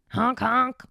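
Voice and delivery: high, loud honking sound; mock clown honk. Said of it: "honk my titties".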